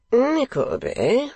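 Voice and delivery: high, drawn-out foppish drawl; foppish young lord. A narrator's reading. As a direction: languid young aristocrat, high. Lord Verisopht voiced.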